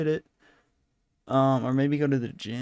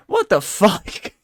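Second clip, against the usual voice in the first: shocked; 4 semitones higher, 6 dB louder, slower than usual. Real, not acted. Shocked at quoted demand.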